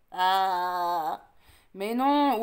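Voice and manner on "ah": long, low "aaah"; open-mouthed "ah". Acted. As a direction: hold a deep "ah" for an examination. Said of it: "ouvre la gueule pour voir". (long « aaah » grave, gueule ouverte)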